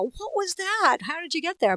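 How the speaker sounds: high, startled questions; amazed parents. Retold or imitated. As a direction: react like stunned parents. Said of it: "my parents would go".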